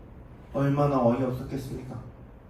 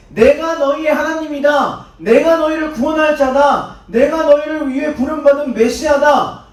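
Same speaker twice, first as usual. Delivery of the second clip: louder, higher solemn declaration; proclaiming; 9 semitones higher, 9 dB louder, slower than usual. Acted. Jesus declaring himself Messiah to the crowd, loud proclamation. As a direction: announce it with full authority. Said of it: "하나님의 이름을 이야기하니까".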